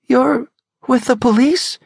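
high, hesitant hopeful question; shaky disbelief. Acted. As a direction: dare to hope. Woman's fragile disbelief.

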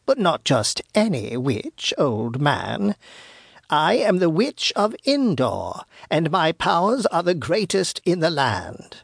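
put-on old crone proudly naming herself; boastful witch. Witch boasts of her powers to old man, grandiose.

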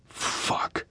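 very soft, voiceless swearing; whispered curse. Acted. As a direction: curse under your breath. Muttered curse on bad news.

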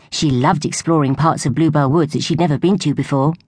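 usual speaking voice

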